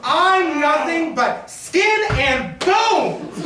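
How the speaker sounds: high, loud, slow wail; hammed-up wail. To the banker, a hammed-up high wail. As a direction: wail it melodramatically. Woman wails her plight.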